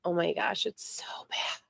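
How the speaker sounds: hushed whisper about the cold. Real, not acted. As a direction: drop to a breathless whisper. Complaining about her head cold.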